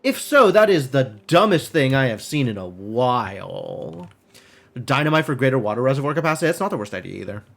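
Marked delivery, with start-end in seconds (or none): none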